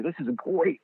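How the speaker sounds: high, delighted; light up like a thrilled kid; kid in a candy store. Retold or imitated. Player delighted with the ball, high and happy. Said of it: "like a kid in a candy store".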